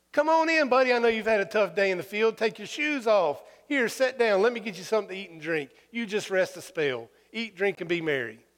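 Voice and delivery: high, coaxing master welcoming servant in; mock doting master. Jesus voicing an overly kind master to his servant, high and coaxing. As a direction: coax him in like a doting host.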